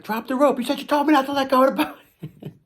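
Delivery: high-pitched comic argument; mock bickering shouts. Son and dad arguing over the boat rope, mock bickering. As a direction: squawk it back and forth in exasperation.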